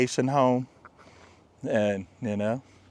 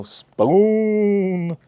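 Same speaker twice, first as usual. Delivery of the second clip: silly high voice; 10 semitones higher, 4 dB louder than usual. Pretend. Odd word in a drawn-out high voice.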